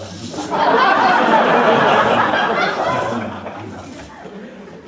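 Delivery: loud breathy laugh; big laugh. Real, not acted. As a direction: break up laughing. Long laugh after joke.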